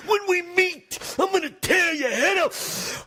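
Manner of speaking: slow, high gravelly wrestler shout; hammed-up wrestler threat; Macho Man impression. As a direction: growl it like a pro wrestler promo. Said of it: "The Macho Man".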